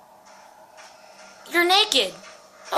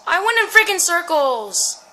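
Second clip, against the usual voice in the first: frustrated shout; 5 semitones higher, 9 dB louder than usual. Real, not acted. Frustrated at getting lost.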